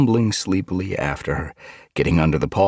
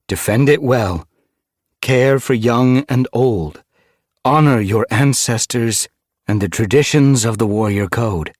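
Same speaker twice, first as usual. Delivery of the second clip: raised, ceremonial leader-naming voice; solemn proclamation; 5 semitones higher, 5 dB louder than usual. Acted. StarClan bestows a new name and nine lives on the leader, solemn proclamation.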